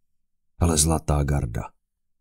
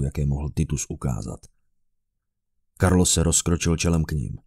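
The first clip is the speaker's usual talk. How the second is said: ordinary delivery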